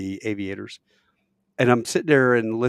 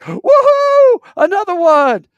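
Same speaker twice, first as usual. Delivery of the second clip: very high, loud cheer; celebrating whoop; 14 dB louder, slower than usual. Retold or imitated. Radio hosts cheering another right guess, mock whooping. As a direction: whoop like a winning show host.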